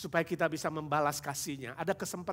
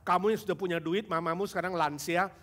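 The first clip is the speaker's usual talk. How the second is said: ordinary delivery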